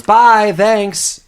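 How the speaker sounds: high, loud mock-chirpy goodbye; cheeky farewell. Kid escaping two burglars, cheeky high call.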